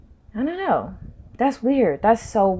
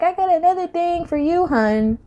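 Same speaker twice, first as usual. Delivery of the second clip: high, teasing voice of Spirit; higher sassy mock voice; 7 semitones higher than usual. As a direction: play Spirit as a sassy friend calling you hun.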